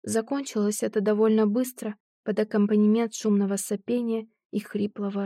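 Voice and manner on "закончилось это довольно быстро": ordinary delivery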